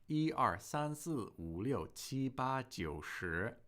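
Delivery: 一二三四五六七八九十 counted slowly, each number clear; counting slowly. Shown as a demonstration. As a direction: count 一二三四五六七八九十 steadily for learners. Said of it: "I can count to ten in Chinese".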